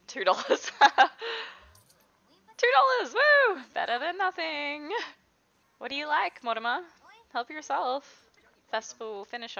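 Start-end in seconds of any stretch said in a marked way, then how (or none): 0.1-3.6 laughing, high, loud woo over a tiny sale; mock-triumphant whoop; gleeful cheer. Real, not acted. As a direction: celebrate two dollars like a jackpot.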